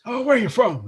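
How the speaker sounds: high, eager mad-scientist question; Doc Brown impression. The Doc Brown actor greeting visitors, manic high voice. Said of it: "he goes".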